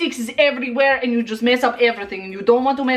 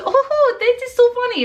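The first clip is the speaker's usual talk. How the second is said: fake amused; 10 semitones higher than usual. Fake laugh at a bad joke.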